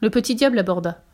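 ordinary delivery, nothing marked

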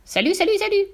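high, rising parrot call; high-pitched talking parrot; parrot cry. A narrator's reading. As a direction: squawk it like a parrot. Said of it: "le perroquet cria".

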